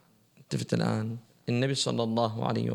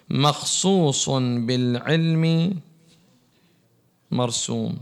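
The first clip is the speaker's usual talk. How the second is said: drawn-out preaching; 4 dB louder, much slower than usual. Acted. Preaching at full force.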